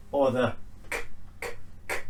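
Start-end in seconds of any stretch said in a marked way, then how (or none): 1.4-2.0 "k" as a soft click of air; voiceless K. Shown as a demonstration. Teacher showing no vibration, a voiceless "k". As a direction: release "k" with no voice. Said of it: "there's no vibration".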